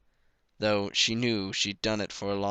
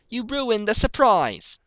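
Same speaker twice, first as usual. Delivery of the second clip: high, loud, slow accusing cry; angry filly; 13 semitones higher, 9 dB louder, slower than usual. A narrator's reading. Young Applejack scolding her brother, loud and angry. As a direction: blame your brother at full volume. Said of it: "glaring up at her older brother".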